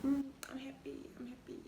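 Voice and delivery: giddy whisper; whispered happy. Retold or imitated. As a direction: breathe it out, delighted. Whispered giddy quote of herself.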